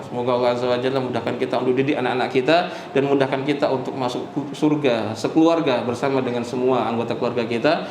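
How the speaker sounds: quick, low, run-on supplication; closing prayer. Real, not acted. Preacher asking God's blessing on everyone's children, closing prayer.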